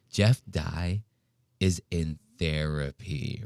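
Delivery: hushed gossip whisper; soft secretive gossip; mock whisper. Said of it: "he's even whispering it".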